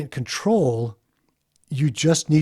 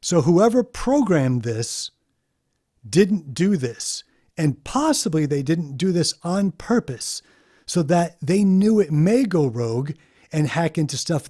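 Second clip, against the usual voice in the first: ordinary delivery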